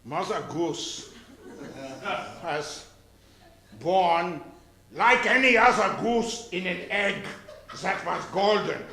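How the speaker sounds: bawdy nursery tale in mock German; put-on German-accented storyteller. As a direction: tell it with a hammed-up German accent. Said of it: "Tell the real story of Mother Goose in this accent".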